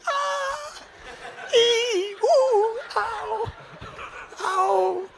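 high loud mock shrieks of pain; imitating shrieks. Ladies across the water stung, high mock shrieks. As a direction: squeal like the ladies being stung. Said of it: "these ladies going".

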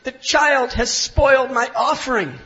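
loud, high cry of alarm. Acted. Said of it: "he cried out".

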